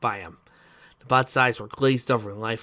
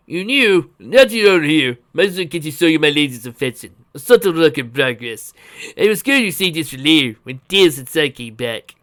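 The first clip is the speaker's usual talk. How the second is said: loud, raised character voice; high-energy, louder old uncle figure; Uncle Chuck, excited inventor; 3 semitones higher, 8 dB louder than usual. Acted. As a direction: break in loudly and eagerly.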